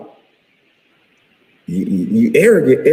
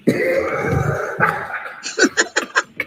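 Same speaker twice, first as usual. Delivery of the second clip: long high wheezing laugh; helpless, high-pitched real laughter; cracking up laughing; 7 dB quieter than usual. Real, not acted. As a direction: crack up at your own joke. Cracks up at own joke.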